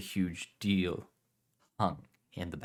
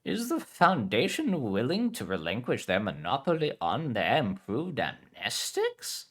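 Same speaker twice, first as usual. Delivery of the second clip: playful; 5 semitones higher than usual. Acted. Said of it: "She spoke playfully".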